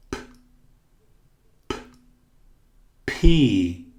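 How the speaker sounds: "P" with a strong puff of air; explosive P. Shown as a demonstration. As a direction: pop the P hard. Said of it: "O P sempre explosivo". ("P" explosivo, com sopro de ar)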